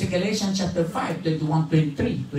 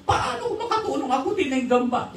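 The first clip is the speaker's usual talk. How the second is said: whiny complainer; 8 semitones higher than usual. Retold or imitated. Mimics believers justifying themselves.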